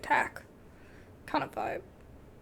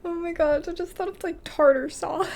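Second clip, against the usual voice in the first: laughing; 3 semitones higher than usual. Real, not acted. Laughs at tartar sauce.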